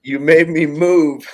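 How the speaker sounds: slow, loud and high, stressing made; emphatic complaint. Retold or imitated. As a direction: drag out you made me move. Indignant retelling.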